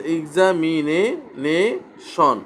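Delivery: এক্সামিনেশন drawn out in parts, ig-za-mi-ne-shon; broken into syllables. Shown as a demonstration. Teacher counts four syllables, এক্সামিনেশন slowly broken apart. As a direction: split এক্সামিনেশন slowly, pausing before the last syllables. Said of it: "এখানে চারটা syllable দেখা যাচ্ছে".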